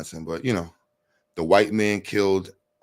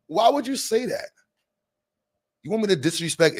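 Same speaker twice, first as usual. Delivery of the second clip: mock outrage; 11 semitones higher than usual. Repeat with higher pitch.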